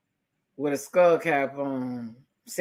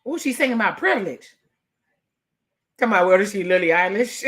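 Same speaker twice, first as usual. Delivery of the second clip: usual speaking voice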